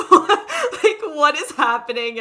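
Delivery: imitating mom, high and loud, laughing through it; hysterical laughing mom. Daughter imitating her mom laughing hysterically at the show. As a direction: mimic mom cracking up in disbelief. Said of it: "my mom's just getting hysterical".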